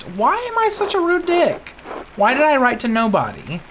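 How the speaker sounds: high, whiny put-on voice; mock self-pity. Voicing the scolded character, high and whiny. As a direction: whine it like a sulky character.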